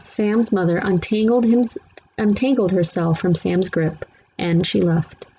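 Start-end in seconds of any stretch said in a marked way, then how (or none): none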